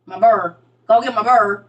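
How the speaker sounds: loud "bird" with a heavy UR, for beard; Saint Louis pronunciation. Shown as a demonstration. Saint Louis speaker insisting, "bird" for beard. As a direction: say "bird" where beard is meant, UR vowel. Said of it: "Like, Saint Louis is her".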